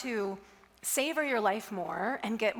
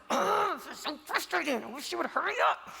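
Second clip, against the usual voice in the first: mock exasperated groan, pitched up; frustrated grumble; 6 semitones higher than usual. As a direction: act out an impatient, fed-up man in line. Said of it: "And the veteran was like".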